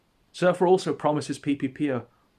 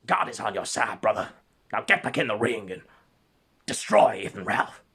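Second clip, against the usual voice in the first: emphatic Southern sermon voice; rousing Southern preacher, punching the key words; 2 semitones lower, 4 dB louder than usual. Acted. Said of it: "my best Southern preacher voice".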